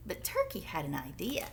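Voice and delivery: secretive whisper; conspiratorial storybook whisper. A narrator's reading. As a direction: let them in on a secret. Sly plotting narration.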